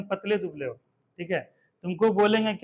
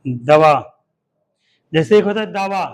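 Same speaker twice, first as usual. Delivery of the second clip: loud, lower called-out दवा; dictation call; 3 semitones lower, 11 dB louder than usual. Shown as a demonstration. Teacher dictating दवा to the pupils, loud. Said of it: "लिखो दवा".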